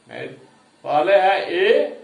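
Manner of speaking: normal talking voice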